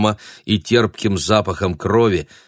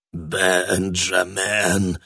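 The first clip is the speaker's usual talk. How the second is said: very slow creaky ghost call; drawn-out creaky calling; much slower than usual. A narrator's reading. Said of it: "позвал его кто-то скрипучим голосом".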